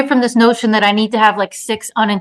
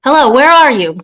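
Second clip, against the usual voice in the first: loud, raised searching call; 4 semitones higher, 6 dB louder than usual. Acted. Woman searching the maze, calling out. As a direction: call out for someone lost. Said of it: "she called".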